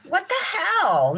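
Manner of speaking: high, slow what-the-hell; exasperated disbelief. Real, not acted. Streamer to chat about the lost money, real disbelief. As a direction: stretch it out in disbelief. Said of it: "trouble of disputing this".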